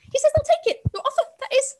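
high, loud squirrel squeak, relieved yet scared; relieved, terrified squeak. A narrator's reading. Squirrel squeaking relieved and terrified at Luca. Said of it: "in a voice that was simultaneously relieved and terrified".

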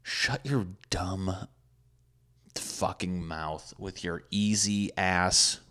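drawn-out, slow put-down; slow insult. Retold or imitated. Snapping back at the date, slow and deliberate. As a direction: drag every word out. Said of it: "I'm just like".

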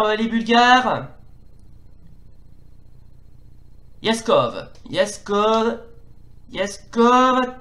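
lively football play-by-play; french sports commentary. Acted. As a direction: call the match live, rising with each attack. (commentaire sportif à la télé)

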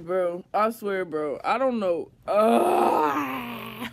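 loud, slow whimpering complaint; half-laughing mock sob; whining fake cry. Real, not acted. After the prank fails, a loud mock cry.

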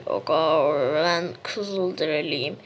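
put-on American accent. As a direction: speak Turkish like an American, slowly. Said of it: "niye Amerikan aksanıyla konuşuyorsun". (Amerikan aksanıyla yavaş konuşma)

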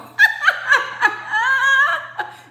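high, loud burst of forced laughter. Shown as a demonstration. Laughing in front of Eshu instead of crying, forced loud laugh. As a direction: laugh it out big while holding back tears. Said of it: "you go".

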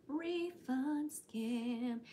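drawn out very slowly. Pretend. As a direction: stretch every syllable out. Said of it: "imitating a scam call".

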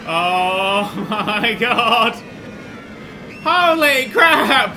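loud, drawn-out gasp of disbelief; real shock. Real, not acted. Player riding his own coaster, shouting in shock. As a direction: scream it out as the drop hits.

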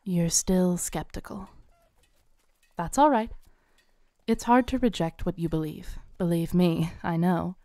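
ordinary talk, nothing marked

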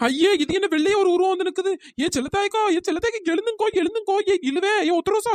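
high-pitched panicky pleading; scared calls to wake everyone; frightened. Acted. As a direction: urgently rouse sleeping friends, voice pitched high. Sees ghost, wakes sleeping friends.

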